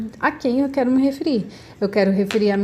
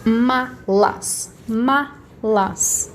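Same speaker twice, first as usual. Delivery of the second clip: malas split slowly into MA and LAS; syllable by syllable; much slower than usual. Shown as a demonstration. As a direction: sound out malas in two separate beats. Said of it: "quando eu falo".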